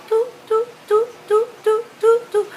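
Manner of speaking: high, rapid button-press toots; short high-pitched electronic toots; imitated phone beeps. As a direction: mimic a cordless phone's keypad beeps. Imitates phone beeps while checking calls.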